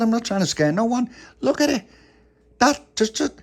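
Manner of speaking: indignant protest. Acted. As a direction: insist you're not scaring anyone. Tony protests loudly.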